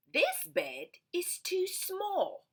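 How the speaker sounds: high, slow fussy voice; storybook visitor. Acted. Storybook visitor fussing about the rain, high and slow. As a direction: stretch out the complaint.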